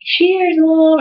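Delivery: high-pitched mock-drunk toast; tipsy dad. Drunk dad toasting Mom, mock-tipsy cheer. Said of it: "a little drunk. He's like".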